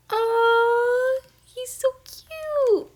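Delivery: very high, drawn-out cooing; adoring gush. Real, not acted. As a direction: melt over something adorable, slow and high. Gushing over the cute villager.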